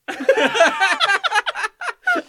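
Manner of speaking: high delighted laugh; loud burst of laughter. Real, not acted. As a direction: crack up laughing. Laughing at parrot blooper.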